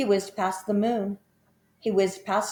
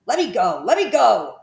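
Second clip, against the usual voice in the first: crocodile yelling; 3 semitones higher, 7 dB louder than usual. A narrator's reading. Dangling crocodile begs loudly.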